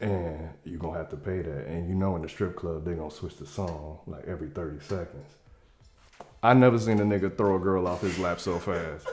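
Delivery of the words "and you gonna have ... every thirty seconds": hushed, much softer secret warning; whispering to a friend. Retold or imitated. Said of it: "I go and whisper in Mike's ear".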